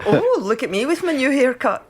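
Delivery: mock vain preening. Friend mocking someone showing off a haircut, preening. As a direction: show off the new haircut.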